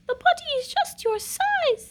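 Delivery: high, sweet queen voice; a gentle queen coaxing her son. Acted. Queen coaxing the little prince, high and sweet.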